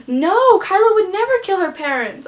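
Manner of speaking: high, loud indignant no; outraged protest. Real, not acted. Fan rejecting the twist, high and loud. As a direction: shout it down in disbelief.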